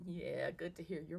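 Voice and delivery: slightly shaky, unsteady voice. Real, not acted. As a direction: voice wavering a little, real. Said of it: "You sound like you a little shaky".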